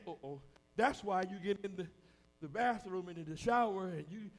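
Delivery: ordinary talk, nothing marked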